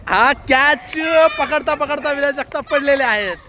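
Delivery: stretched-out, rising call of the catch; excited live cricket call; excited catch commentary. Real, not acted.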